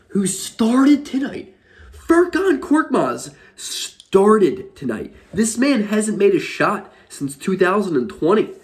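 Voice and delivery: high-pitched, drawn-out disbelief on each key word; incredulous outrage. Real, not acted. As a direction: stretch it out high, appalled at the decision. Incredulous rant over the starting lineup.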